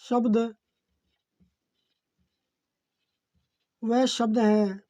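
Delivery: measured note-taking dictation, phrases repeated. Real, not acted. Teacher dictating a definition for students to write, dictation. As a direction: dictate word by word with long pauses.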